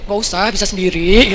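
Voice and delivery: loud, drawn-out show of independence; feigned pride. Acted. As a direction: refuse with put-on pride, stretching the end. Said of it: "Saya pura-pura gengsi gitu dong".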